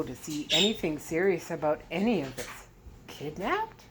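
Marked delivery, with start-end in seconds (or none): 3.1-3.8 sarcastic. A narrator's reading. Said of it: "queried sarcastically".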